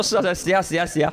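imitating a dad's Cantonese-accented Mandarin; light Guangpu imitation. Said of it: "就是他说". (模仿父亲的广东口音普通话)

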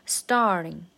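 "Starting" with a strong first syllable; stressed word. Shown as a demonstration. Teacher pointing out the accent, stressed "Starting". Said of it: "是一个重音".

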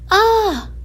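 high, loud yelp; high startled cry. A narrator's reading. Little cloud hit by splashed water, high yelp.

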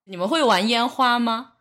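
usual speaking voice

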